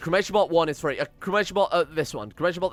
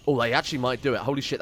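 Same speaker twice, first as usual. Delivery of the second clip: usual speaking voice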